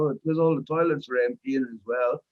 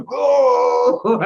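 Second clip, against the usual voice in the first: long, high, loud "oh" of sliding passengers; imitated crowd yell; 9 semitones higher, 8 dB louder than usual. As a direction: cry out as if thrown across the deck. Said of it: "they all go back the other way".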